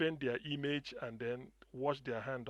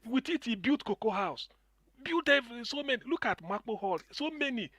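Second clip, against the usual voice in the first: rising into a passionate higher-pitched rant; excited; 8 semitones higher, 5 dB louder than usual. Real, not acted. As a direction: get louder and higher as the outrage builds. Caller grows heated about Awolowo.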